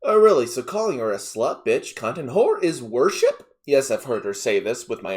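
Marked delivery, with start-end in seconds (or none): none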